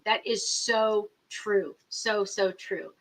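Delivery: slow, soft heartfelt agreement; slowly agreeing with a viewer; slow emphatic agreement. Real, not acted. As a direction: draw it out, really agreeing.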